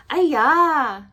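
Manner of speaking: high, loud cry of alarm; girl crying out at the monster. Acted. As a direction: shriek it out in fright. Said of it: "Xingling cried".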